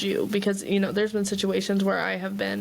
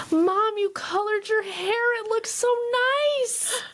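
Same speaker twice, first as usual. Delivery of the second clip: put-on compliment mom like a little boy; sweet kid voice; 13 semitones higher than usual. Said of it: "he's like".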